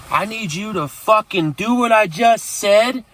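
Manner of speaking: reenacted furious command to the girlfriend; retold angry order. Threatening girlfriend as cops follow.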